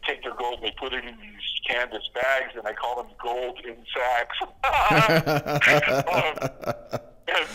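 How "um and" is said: real burst of laughter, louder and higher; laughing at own joke. Real, not acted. Author amused by own gold-in-sacks pun, laughing. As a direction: crack up at your own pun, laughing into the 'um'. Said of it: "I shouldn't be laughing at my own stuff".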